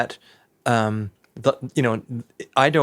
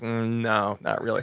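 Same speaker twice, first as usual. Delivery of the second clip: softer, dry dismissal; dismissive verdict; 6 dB quieter than usual. Retold or imitated. Kardashians judging her look, soft and dismissive. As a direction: let it drop quietly and dismissively. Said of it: "they were like".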